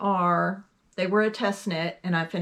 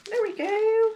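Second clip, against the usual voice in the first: very high, louder singsong; high singsong triumph; 5 dB louder than usual. Real, not acted. Putting the piece back on there, high singsong triumph. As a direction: pipe it up high, drawing it out. Fixing the bag, repeated playfully.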